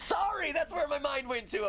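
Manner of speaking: high, sheepish apology; embarrassed. Real, not acted. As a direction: laugh it off, flustered. Embarrassed after weird joke.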